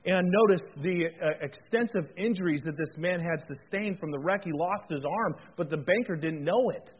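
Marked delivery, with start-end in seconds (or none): none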